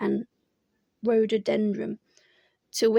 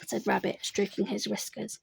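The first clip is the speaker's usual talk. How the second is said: usual speaking voice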